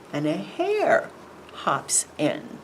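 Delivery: ordinary delivery, nothing marked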